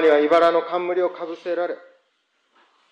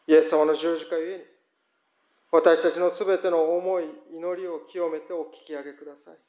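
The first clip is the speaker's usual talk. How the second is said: prayer; 4 semitones lower than usual. Acted. Pastor praying for churches before congregation, reverent. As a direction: pray steadily for the church and the world.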